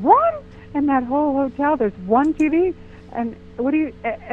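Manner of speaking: "One?" leaps way up, loud; shocked disbelief. Retold or imitated. Visitor stunned there is only one TV in the hotel, loud disbelief. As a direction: gasp it out in amazed disbelief.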